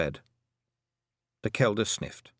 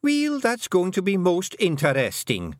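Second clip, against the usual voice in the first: sharp; 11 semitones higher, 4 dB louder than usual. Acted. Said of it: "said Fionn sharply".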